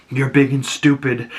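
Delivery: ordinary talk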